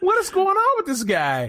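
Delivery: high, laughing question; laughing disbelief. Real, not acted. Recounting a hot dog video, laughing disbelief. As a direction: ask it while cracking up.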